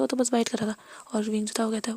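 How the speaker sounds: ordinary delivery, nothing marked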